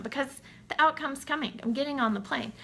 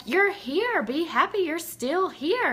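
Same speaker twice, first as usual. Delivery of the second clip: higher, swooping reminder; mock pep talk; 6 semitones higher than usual. Partner reminding a sad traveler to be happy, lightly chiding. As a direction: imitate a partner's upbeat nudge.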